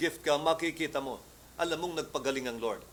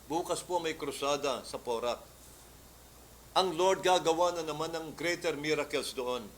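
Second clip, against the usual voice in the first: usual speaking voice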